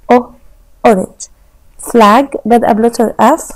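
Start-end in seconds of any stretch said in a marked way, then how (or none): 0.1-0.3 isolated "او" sound as an o; letter sound. Shown as a demonstration. Teacher sounding "او" for young pupils, crisp. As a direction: sound out "او" as the o in orange. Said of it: "بادئة بلتر او".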